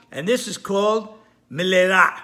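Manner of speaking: ordinary delivery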